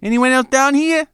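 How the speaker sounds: high, loud call into the crack; calling out into a narrow crack; yelling into a crack. Acted. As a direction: shout down the gap to whoever's there. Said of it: "yell into the crack and say".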